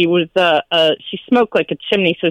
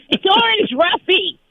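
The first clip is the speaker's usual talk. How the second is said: mother-in-law's voice; 10 semitones higher, slower than usual. Retold or imitated. Quoting the mother-in-law.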